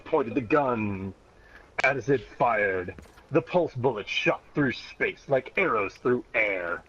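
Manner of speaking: usual speaking voice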